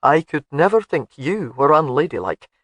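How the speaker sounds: gruff but fond; gruff husband. A narrator's reading. Alec softening toward his wife.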